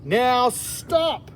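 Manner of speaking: high-pitched order; Max orders. Acted. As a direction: stop them like a small king. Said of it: "said Max".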